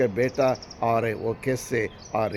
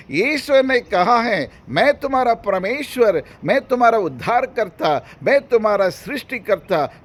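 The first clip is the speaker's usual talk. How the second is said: high, ringing declarations in a repeated cadence; full-force preaching; 7 semitones higher, 6 dB louder than usual. Acted. Preacher voicing God's promise to frightened believers, full-force preaching. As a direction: proclaim as God's own promise to the fearful.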